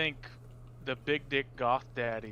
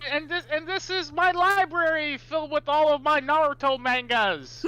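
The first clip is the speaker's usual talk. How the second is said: high, loud proud showing off; excited showing off; 12 semitones higher, 7 dB louder than usual. Pretend. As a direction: show it off proudly. Showing off his library.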